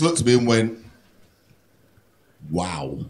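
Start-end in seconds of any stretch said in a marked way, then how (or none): 2.5-3.0 very low, soft deadpan reaction; understated wow. Retold or imitated. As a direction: imitate a quietly stunned clerk. Clerk stares, then reacts to rant.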